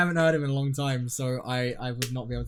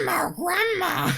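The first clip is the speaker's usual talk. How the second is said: high, slow crying puppet voice; Elmo grandma; 4 dB louder, slower than usual. Acted. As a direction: whimper it like an old puppet granny. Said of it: "What about Elmo angry?".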